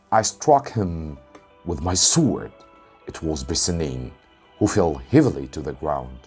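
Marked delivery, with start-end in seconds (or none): none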